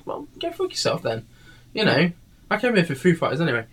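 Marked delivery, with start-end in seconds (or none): 0.1-1.3 high, soft acted comeback; muttered mock retort. Audience member answering the insulting singer, high quiet retort. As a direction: toss back a retort, high and under your breath.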